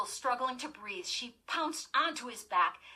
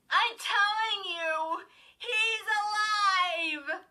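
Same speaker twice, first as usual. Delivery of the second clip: high, very slow, crying wail; desperate cry; 12 semitones higher, 7 dB louder, much slower than usual. A narrator's reading. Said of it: "Chris cried desperately".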